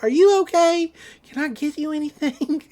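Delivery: high, fussing concern; worried deputy. Retold or imitated. Deputy fussing over his out-of-breath partner, high and worried. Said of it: "Deputy Durlin's like".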